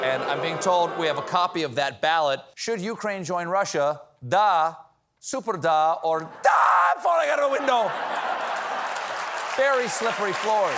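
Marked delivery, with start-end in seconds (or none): 1.6-10.7 mock ballot announcer.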